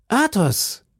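hoch und freudig gerufen; überraschter Ruf. A narrator's reading. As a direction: ruf überrascht den Namen. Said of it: "riefen die zwei Musketiere". (überraschter Ruf Athos!)

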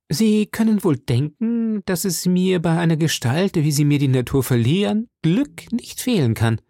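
lightly played proud Porthos; boastful Porthos. A narrator's reading. Porthos boasting, voiced higher.

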